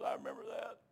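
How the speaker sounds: hushed, whispered reply; imitating a frail man's whisper; weak dying friend. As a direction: answer faintly, as from a sickbed. Voices dying friend's weak answer.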